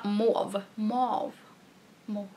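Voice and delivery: "Mauve" said softly as "mov", testing the French word; sounding out "Mauve" carefully like "mov", short o, no final e; trying to pronounce mauve. Shown as a demonstration. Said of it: "Det är jättesvårt att uttala".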